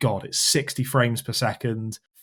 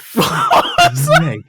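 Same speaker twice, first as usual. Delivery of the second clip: sudden high shriek of disbelief; shocked exclamation; 9 dB louder than usual. Real, not acted. Host sees his colleague's outfit, shrieks in shock. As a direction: blurt it out, squealing with shock.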